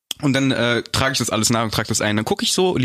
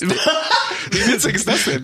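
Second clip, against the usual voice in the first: laughing; 8 semitones higher, much slower than usual. Real, not acted. Laughs at the podcast-day news.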